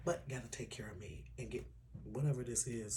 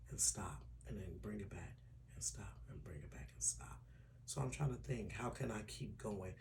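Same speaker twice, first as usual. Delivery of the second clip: soft, lower whisper; 4 semitones lower, 8 dB quieter than usual. Real, not acted. Describing stop-start recording, whispering. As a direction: drop the voice to breath. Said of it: "'cause I can whisper".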